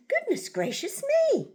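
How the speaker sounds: hammed-up motherly shock; exclaiming. A narrator's reading. Narrator voices mother seeing her reflection, exclaiming. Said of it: "and exclaimed".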